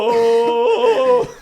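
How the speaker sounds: loud high comic yelp; a big silly Jim Carrey yelp; hammy yelp. Retold or imitated. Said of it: "doing the".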